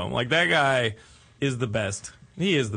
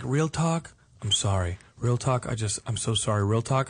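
put-on low drunk mumble; 6 semitones lower than usual. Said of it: "he kept being like".

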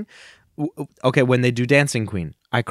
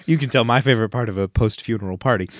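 words tumbling out fast through a laugh; faster than usual. Real, not acted. Still laughing, teasing herself to the co-host. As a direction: talk through real laughter. Laughing at own sub story.

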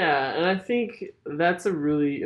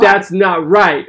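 loud; 3 semitones higher, 13 dB louder, slower than usual. Retold or imitated. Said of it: "you were like".

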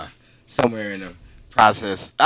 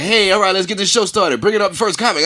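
hyped emcee impression, raised pitch; mimicking the host; 7 semitones higher, 3 dB louder, faster than usual. Comic retelling the host's intro, hyped emcee voice. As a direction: pump up the room like a cheesy host. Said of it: "He went up, was like".

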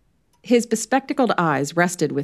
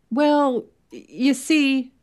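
higher, slow, halting start; faltering; 9 semitones higher, much slower than usual. Acted. Merton nervous before the inspector, faltering. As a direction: stumble over the first words. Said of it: "Merton faltered".

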